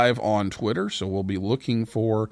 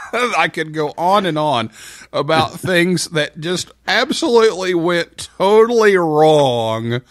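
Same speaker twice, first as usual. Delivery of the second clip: laughing; 7 semitones higher, 8 dB louder than usual. Real, not acted. Laughs about weekend mishaps.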